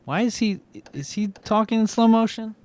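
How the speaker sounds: ordinary talk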